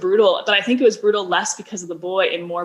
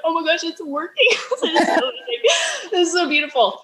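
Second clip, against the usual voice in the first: high, laughing squeal of joy; laughing excitement; 10 semitones higher than usual. Retold or imitated. Telling the host about first online money, laughing giddily. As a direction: relive the thrill, laughing through it.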